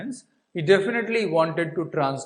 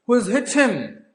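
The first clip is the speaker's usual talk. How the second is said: sharp, higher shocked question; 8 semitones higher, 4 dB louder than usual. Retold or imitated. Officer seeing the injured man, shocked higher question. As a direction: demand to know who did it. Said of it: "he, he said".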